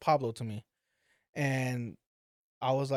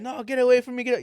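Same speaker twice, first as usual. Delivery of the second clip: high, pushing away in disgust; panicked yelling; 8 semitones higher, 4 dB louder, faster than usual. Retold or imitated. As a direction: yell them away.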